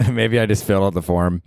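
ordinary delivery

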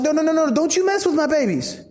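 a touch of a protective father; guard your babies, firm and high; protective Paul. Retold or imitated. Paul defending the Philippian church, lightly voiced protective anger. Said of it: "You can hear it in his voice".

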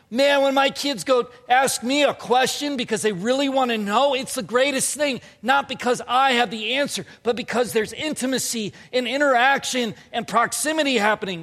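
loud, high, driving sermon delivery; impassioned preaching. Real, not acted. Preaches at full force.

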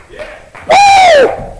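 very high joyful whoop; ecstatic whoop. Real, not acted. Preacher crying out in joy at the climax, high whoop. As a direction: let out a holy whoop. Ecstatic cry at sermon peak.

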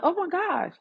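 gushing to herself about loyal listeners, slowing at the end; delighted, high-pitched retold reaction; delighted gasp. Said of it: "I'm like".

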